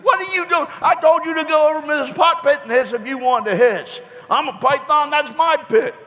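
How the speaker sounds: shrill it up like a fed-up mum; scolding mother. Acted. Mother snake catching Johnny hissing, high and exasperated.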